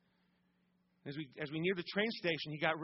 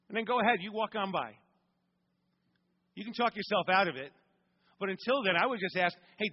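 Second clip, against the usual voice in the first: louder, higher rousing sermon delivery; preaching at full force; 4 semitones higher, 4 dB louder than usual. Acted. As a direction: drive it home to the congregation.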